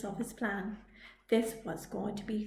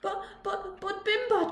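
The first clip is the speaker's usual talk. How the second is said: stammering; 9 semitones higher than usual. A narrator's reading. Stammering surprise.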